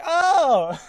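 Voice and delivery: loud, high drawn-out oh; sudden realization. Retold or imitated. Reenacting getting the teacher's joke about his shirt, loud realization. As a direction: act out the penny dropping.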